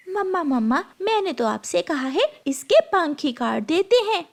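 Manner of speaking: put-on high-pitched, spiteful tattling girl; spiteful stepsister. Stepsister urges mamma to clip Gudiya's wings, spitefully. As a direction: egg mother on in a high voice.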